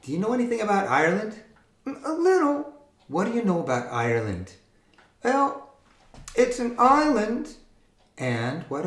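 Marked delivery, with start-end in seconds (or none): 1.8-2.7 high squeaky puppet reply. Acted. Puppet voice answers. 5.2-7.6 high squeaky puppet reply. Acted. Puppet voice answers.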